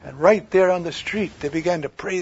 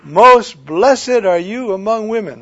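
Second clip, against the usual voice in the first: loud, slow proclaiming cry; 3 semitones higher, 10 dB louder than usual. Acted. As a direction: cry out a blessing. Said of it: "cried out in a loud voice".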